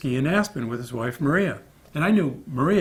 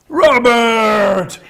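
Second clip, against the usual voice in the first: loud, much higher greeting cry of Robert; happy shout; 9 semitones higher, 11 dB louder than usual. Retold or imitated. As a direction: call out across the street in delight. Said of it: "he goes".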